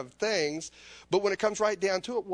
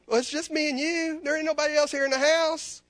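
God's voice calling out; 10 semitones higher, 6 dB louder than usual. Retold or imitated. God answers in acted dialogue.

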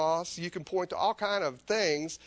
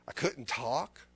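ordinary talk

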